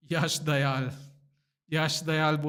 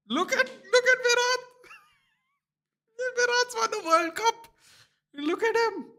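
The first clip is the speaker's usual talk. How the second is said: high, delighted shriek of amazement; excited squeal. Real, not acted. Fan sees Virat celebrating, high excited squeal. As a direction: point and shriek with glee.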